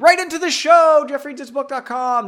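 loud, high plug for the show; announcer voice. Pretend. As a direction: belt it like a radio promo. Repeated mock show plug.

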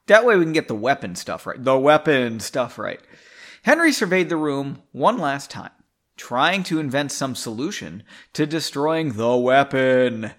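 ordinary delivery, nothing marked

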